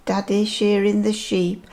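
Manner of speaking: ordinary delivery, nothing marked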